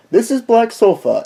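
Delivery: mock British accent. Guest introduces the channel in a mock British accent. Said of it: "my British accent does sound a little".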